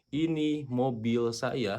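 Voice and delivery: very slow dictation; slow example sentence. Shown as a demonstration. Teacher reading the Indonesian example slowly. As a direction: drag each word for the class. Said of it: "berarti bahasa Jepangnya adalah".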